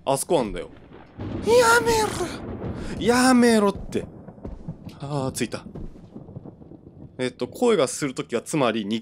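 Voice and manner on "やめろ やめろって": high shouted stop, then slower plea; frantic yell. Real, not acted. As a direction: shout it out in alarm. Yelling at game threat.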